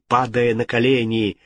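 usual speaking voice

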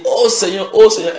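high, loud imploring cry; mimicked plea. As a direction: imitate a believer calling on the Lord. Mimicking a believer's plea to God. (supplication imitée, aiguë)

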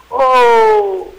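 long, drawn-out loud cry, voice jumping high; excited shout. A narrator's reading. Rabbit suddenly remembers the magic word, drawn-out loud cry. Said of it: "وأخيرًا صاح".

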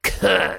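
rasping cough; creaky cough. Acted. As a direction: croak out a cough. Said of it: "проскрипел я".

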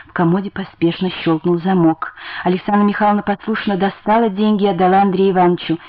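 plain reading voice

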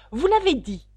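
loud, high contradiction; sharp retort. A narrator's reading. Mock Turtle contradicts Alice, loudly and sharply.

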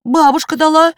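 высокий детский голос Жорика; ответить высоко, как соврвший малыш; смущённый мальчик. A narrator's reading. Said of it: "и покраснел". (смущённо, по-детски)

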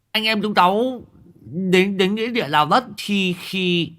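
high, eager lisping voice; lisping boy. Acted. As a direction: cut in excitedly with a lisp. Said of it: "Thằng Ngọng nó lập tức cướp lời". (thằng Ngọng giành lời, giọng cao ngọng nghịu)

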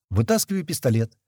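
usual speaking voice

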